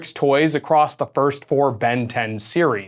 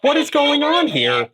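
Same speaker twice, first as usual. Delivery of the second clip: voice changer. Shown as a demonstration. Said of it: "sounds like the most normal guy".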